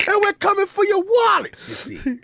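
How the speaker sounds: laughing mock threat; hammed-up taunt; mock threatening character. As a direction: rub it in with a grin. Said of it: "I don't like you in this accent".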